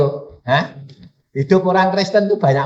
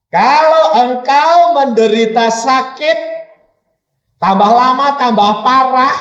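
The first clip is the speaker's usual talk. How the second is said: loud, high, drawn-out challenge; raised preacher's voice, stretched out; forceful preaching; 9 semitones higher, 7 dB louder, slower than usual. Real, not acted. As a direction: press each phrase on the congregation. Preacher poses challenge to congregation.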